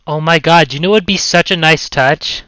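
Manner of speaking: loud, sudden burst of excitement; excited. Real, not acted. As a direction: blurt out the idea, thrilled. Sudden excited idea while working.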